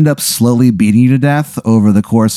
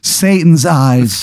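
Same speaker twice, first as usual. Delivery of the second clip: slow, raised-pitch imitation of horror; mock scream; 6 semitones higher, much slower than usual. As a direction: draw out a half-hearted horrified cry. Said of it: "she screams".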